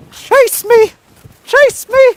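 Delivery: very high playful squeal; squealing taunt. Pretend. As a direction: squeal it like a teasing kid.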